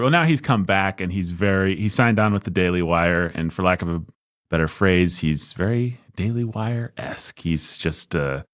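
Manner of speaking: ordinary delivery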